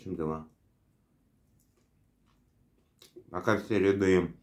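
chewing while talking; talking between bites; mouth full. Real, not acted. Seen 3: Bites small burger 0.7–2.7, then chews with full mouth while talking.

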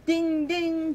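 trolley bell ding. Acted.

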